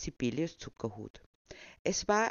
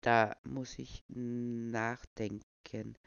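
very slow, unhurried; calm old man; 3 semitones lower, much slower than usual. Pretend. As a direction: take all the time in the world. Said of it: "nach wie vor gelassen".

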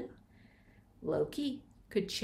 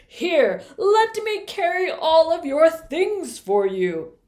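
booming frost giant; 3 semitones higher, 10 dB louder than usual. Acted. Giant introduces himself.

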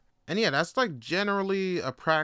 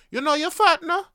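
mocking kid voice; 12 semitones higher, 7 dB louder than usual. Kid taunting him.

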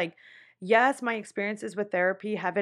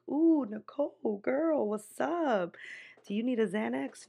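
imagined teasing listener; 3 semitones higher, 5 dB quieter than usual. Retold or imitated. Listeners imagined teasing Nicole about sounding crazy, mock concern. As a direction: tease her as a sassy friend. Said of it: "You guys are probably like".

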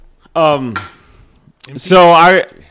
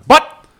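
"But" pitched much higher and louder; rising but; 10 dB louder than usual. Retold or imitated. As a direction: hit "But" high and loud. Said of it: "and he'll go up".

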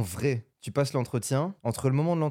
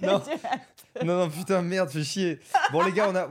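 laughing protest; 6 semitones higher than usual. Real, not acted. Laughing while swearing off jokes.

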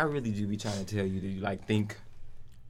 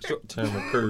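laughing; 4 dB louder than usual. Pretend. Repeats joke laughing.

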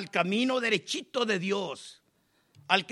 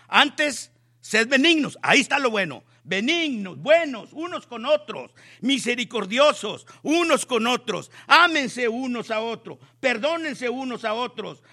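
louder, pressing exhortation; fervent preaching; 2 semitones higher, 5 dB louder than usual. Real, not acted. Preacher commanding the church to forgive, fervent. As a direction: drive each command home.

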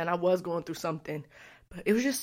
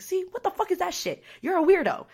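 mock scolding; 12 semitones higher, 4 dB louder than usual. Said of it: "And I was like".

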